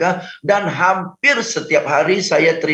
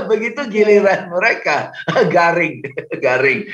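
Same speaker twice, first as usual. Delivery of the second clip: amused, chuckling between the words; laughing. Real, not acted. Trainer recalling trainees' awkward first tries, laughing. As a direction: break into chuckles at their stiff delivery.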